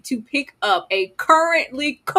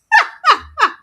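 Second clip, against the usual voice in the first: loud high laugh; 9 dB louder than usual. Real, not acted. Cracking up at being called a good clean girl.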